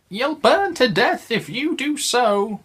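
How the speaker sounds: very high little cat voice warning; cat's warning. Acted. Story cats warn Harriet about the matches, very high. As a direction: squeak it like a fretful kitten.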